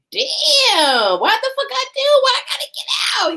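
high, loud hammed-up wail; shouted protest. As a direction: yell it as an outraged, pleading complaint. Retold protest at being thrown out.

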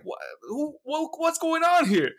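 high, stammering disbelief; acted bewilderment. Recalling his shock at Bane's voice, baffled.

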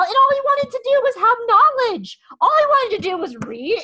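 breathless fan enthusiasm; excited gush. Real, not acted. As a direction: pitch shoots up with fan excitement. Excited gush about the character.

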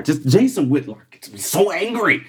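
ordinary delivery

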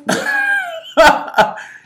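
high-pitched burst of laughter; high cackling laugh. Real, not acted. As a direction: laugh out loud, high and sudden. Reacting to own Draymond remark.

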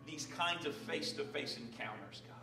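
soft, earnest prayer voice; opening prayer. Acted. Pastor praying over the congregation, hushed and earnest. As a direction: pray quietly before the sermon.

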